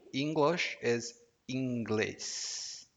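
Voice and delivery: slowly, word by word; slow model phrase. Shown as a demonstration. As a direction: say it slowly for learners to copy. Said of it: "Repeat, please".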